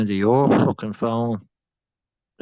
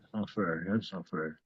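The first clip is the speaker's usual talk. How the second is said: much softer muttered protest; quiet complaint; 3 semitones lower, 12 dB quieter than usual. Real, not acted. Player losing the spelling game, complaining quietly. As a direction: grumble it under the breath.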